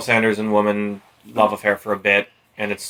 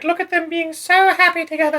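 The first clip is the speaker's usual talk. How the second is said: much higher, mock-sweet gushing. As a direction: gush it like a lovestruck fan. Mocking voice of onlookers.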